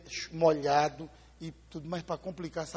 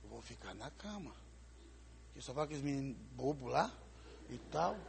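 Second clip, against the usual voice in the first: inner thought; 4 semitones lower, 13 dB quieter than usual. Retold or imitated. Aí eu pensei assim.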